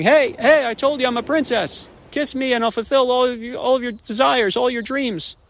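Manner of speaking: high, pleading frog voice from inside a pocket; high frog princess begging from a pocket; muffled frog in a pocket. Acted. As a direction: squeak up insistently from a pocket. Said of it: "voice of the frog saying".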